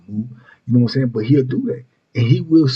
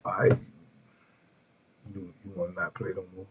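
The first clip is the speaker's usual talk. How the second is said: quiet calm; 4 semitones lower, 12 dB quieter than usual. Retold or imitated. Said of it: "He'd just be like".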